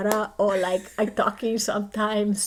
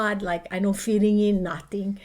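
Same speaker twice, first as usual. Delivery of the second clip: usual speaking voice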